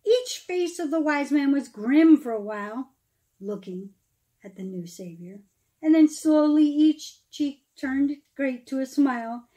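lively storybook read-aloud, rising and falling; storyteller reading verse aloud; reading to children. Acted. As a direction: read the Christmas rhyme to a room of kids. Reads Christmas poem to children.

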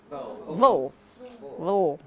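vo, quick v into open o; vo demo. Shown as a demonstration. Teacher drilling vo for the class. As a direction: say vo, quick v then o. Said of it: "you're gonna say vo".

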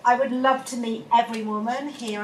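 ordinary delivery

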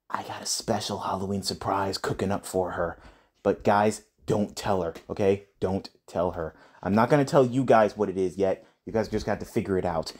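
low, hushed secret-telling; secretive hush. Real, not acted. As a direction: keep it between us quietly. Secret surprise from girlfriend.

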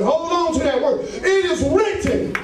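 rising preacher's cadence, calling out; full-force preaching. Acted. Preacher calling out to the congregation, fervent.

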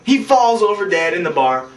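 normal talking voice

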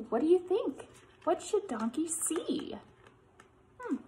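usual speaking voice

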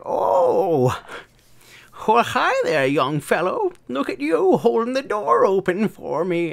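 put-on high, sweet old-lady voice; grandma voice. Grandma cooing at the man holding the door. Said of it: "grandma's like".

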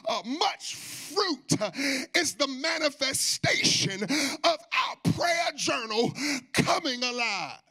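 high, loud, slow preaching cadence; full-force preaching. Real, not acted. Preacher proclaims answered prayer to the congregation, slow and loud. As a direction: proclaim it, stretching every phrase.

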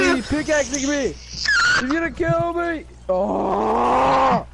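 hyped gamer bro. Real, not acted. Being attacked in game.

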